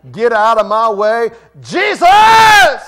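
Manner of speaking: desperate high shout; crying out. Acted. Blind beggar shoving past the crowd to shout for Jesus, loud and high. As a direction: push through the crowd and scream. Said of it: "he cried out all the much more".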